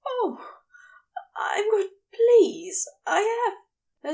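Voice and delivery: high, breathy begging; breathless pleading. Acted. Angel begging Crowley, high and breathless.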